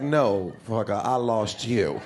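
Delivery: low, slow, deliberate comeback; blunt retort. Acted. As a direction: drop the voice and drag it out, owning the hang-up. Caller owning up to hanging up.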